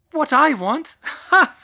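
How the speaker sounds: high, loud, bitter laugh; strangled laugh. Acted. Hermione laughing bitterly at George's question. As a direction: choke out a hard laugh. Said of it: "Hermione gave a strangled laugh".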